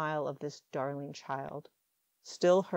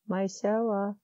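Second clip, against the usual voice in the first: a hint of a sleepy little girl; lightly voiced small child; 4 semitones higher, 4 dB louder than usual. A narrator's reading. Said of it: "the sudden small voice".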